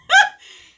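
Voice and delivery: very high, loud laugh; sudden real laughter; burst of laughter. Real, not acted. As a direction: crack up suddenly. Laughing aloud.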